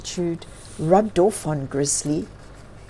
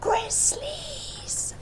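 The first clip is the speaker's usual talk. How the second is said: a sharp, softer whispered gasp of a word; shocked whisper; 9 dB quieter than usual. Acted. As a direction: whisper it in alarm so no one hears. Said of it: "She whispered".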